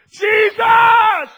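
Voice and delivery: full-voiced high shout; crying out. People calling out to Jesus, crying out. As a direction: cry the name out long and loud. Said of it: "People cry out".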